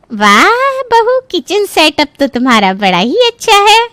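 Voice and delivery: very high, stretched-out exclamation; amazed neighbour. Acted. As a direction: gush with exaggerated admiration. Visitor comments on kitchen in hall.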